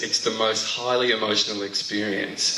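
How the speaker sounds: ordinary talk, nothing marked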